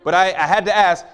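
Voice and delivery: usual speaking voice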